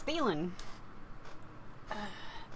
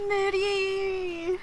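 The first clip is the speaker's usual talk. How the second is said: calling out; 8 semitones higher than usual. Acted. Calling out a fallen ally.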